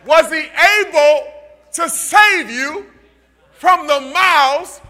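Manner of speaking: slow, loud, drawn-out preaching; slow loud preacher calling into the den; preaching at full force. Acted. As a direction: build the call like a sermon climax. Sermon retelling the king at the den.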